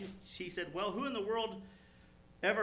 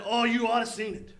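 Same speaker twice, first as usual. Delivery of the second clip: louder, mildly excited baseball fan; lightly acted excitement; excited retelling; 3 semitones higher, 8 dB louder than usual. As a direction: imitate a fan bursting to tell it. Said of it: "he just got all excited".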